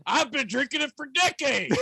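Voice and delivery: high put-on voice mimicking a friend; mocking imitation. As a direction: parrot his words in a silly voice. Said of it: "he just said it".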